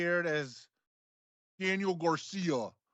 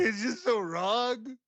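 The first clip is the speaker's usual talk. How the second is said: melodramatic lover; 7 semitones higher, slower than usual. Pretend. Mock soap breakup scene.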